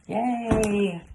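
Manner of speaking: usual speaking voice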